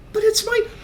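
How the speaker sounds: put-on very high little-boy protest; Geordie Thomas. Son protesting to Mum, high child voice. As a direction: squeak it out like a small child. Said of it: "as little Geordie Thomas".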